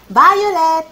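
loud, high call to wake a friend. Acted. Luce calls sleeping friend from under the window, calling out. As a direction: shout the name up at a window. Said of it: "cominciò a chiamare".

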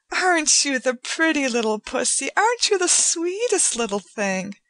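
put-on high sing-song baby talk to a cat; woman doting on a cat; cooing to a pet. A narrator's reading. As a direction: coo like talking to a pet. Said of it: "cooing the silly little words".

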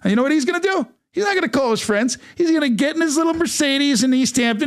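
high-pitched, animated mock warning; worked-up, playful tale of retaliation; joking threat. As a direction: keep the pitch up, building a comic threat.

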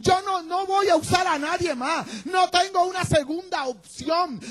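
high, full-force preacher shouting as God; impassioned divine shout. Acted. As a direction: thunder God's choice at Moses, building to a peak. Dios exhorta a Moisés, predicado. (grito apasionado de Dios a Moisés)

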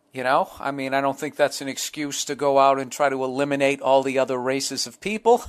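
ordinary delivery

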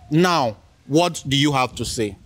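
ordinary talk, nothing marked

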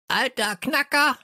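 very high croaking parrot; parrot squawk. Acted. Parrot insulting the old man, very high squawk. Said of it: "krächzte Störtebeker".

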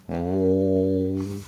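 long, steady dinosaur bellow; sustained hollow animal call; tuba sound. A narrator's reading. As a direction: hold one hooting tuba note. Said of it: "making her tuba sound".

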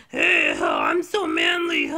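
very low, slow mock he-man; hammed-up deep, manly voice; bad manly voice. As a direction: force out a terrible macho voice. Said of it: "I'm so terrible at manly voices".

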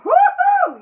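high loud whoop; high, loud celebratory whoop; cheering woo-hoo. Acted. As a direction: let out a big whoop of joy. Cheer in reading.

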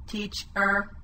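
monotone robot, two chopped pieces; robot speak. Shown as a demonstration. Teacher chops words into pieces, monotone robot voice. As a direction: split it evenly like a robot. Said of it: "chopping the words into pieces".

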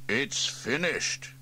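slow, proud announcement; announced importantly. A narrator's reading. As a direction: make it a grand reveal. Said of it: "he announced importantly".

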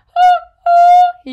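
high, loud hooting horn blasts; little H blowing his horn for help; horn call. A narrator's reading. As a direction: toot like a horn calling for help. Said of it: "he blew the horn".

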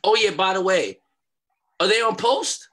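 a leader insisting the guests stand beside him. Retold or imitated. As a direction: call it out like giving orders to security. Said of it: "and says to Supreme".

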